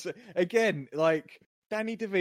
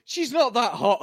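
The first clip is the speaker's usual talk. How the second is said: indignant denial; 9 semitones higher, 9 dB louder than usual. Retold or imitated. Mac defending himself to the gang.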